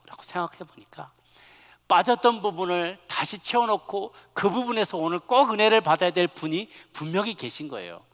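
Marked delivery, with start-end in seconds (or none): 1.9-8.0 loud, raised preacher's voice; loud, urgent pulpit delivery; impassioned preaching. Real, not acted. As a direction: press the message home with force. Preaches at full force.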